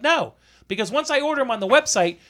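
high-pitched, heated retold objection; indignant refusal. Buyer refusing to order before confirmation, indignant.

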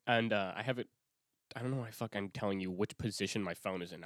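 ordinary talk

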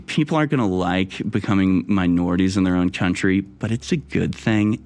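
mock-soothing, patronizing impression; condescending. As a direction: talk down to them with fake sympathy. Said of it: "condescending people you can possibly imagine".

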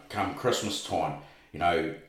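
ordinary talk

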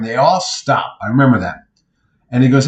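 ordinary talk

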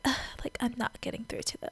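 hushed, whispery exasperated grumble; exasperated aside. Retold or imitated. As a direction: imitate a quiet, frustrated sigh to oneself. Katie's frustrated aside in retold scene.

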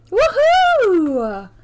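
high, loud whoop of joy; excited cheer. Real, not acted. Cheering checklanes arriving.

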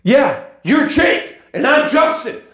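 high, loud announcer-style holler; shouted show intro. Pretend. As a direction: belt out the podcast intro at the top of your register. Hosts shout the show's intro.

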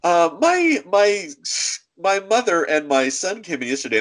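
a light imitation of a flustered mother; upset mom. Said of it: "and she says".

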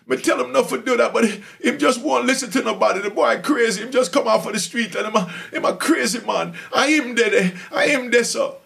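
high, eager Jamaican voice naming names; Jamaican prisoner. Retold or imitated. Inmate pointing out the narrator to guards, in a high Jamaican accent.

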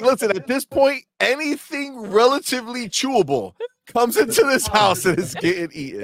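imitating a hungry friend. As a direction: play up a ravenous man's announcement. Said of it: "So he's like".

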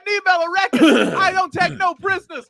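very high, shouting intro announcer; hype announcer. Acted. Announcing the podcast's yearly intro, very high hammed-up shout. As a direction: bellow it like a radio hype drop.

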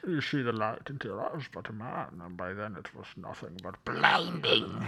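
mock Bane, the movie villain; Bane impression. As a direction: do a muffled Bane threat. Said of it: "Uh, my Bane?".

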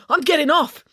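high, urgent declaration; agitated high announcement; desperate insistence. A narrator's reading. Desperate April heads for the door.

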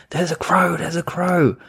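imitated frightened scream. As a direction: mimic a woman shrieking at a crow, held back. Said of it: "like, start screaming".

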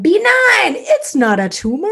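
high, loud bingo call; bingo caller. Pretend. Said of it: "or, like, you know, like".

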